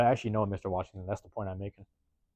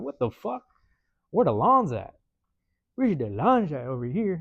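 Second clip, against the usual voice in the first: baffled; 4 semitones higher, 3 dB louder, slower than usual. Retold or imitated. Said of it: "I was like".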